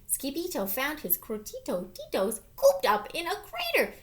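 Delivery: usual speaking voice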